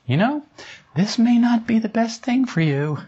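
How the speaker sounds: high, tiny voice of conscience; little inner voice. Retold or imitated. Inner voice cautioning a woman after her argument, high and tiny. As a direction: pipe up high, as a timid inner voice. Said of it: "this little tiny voice said".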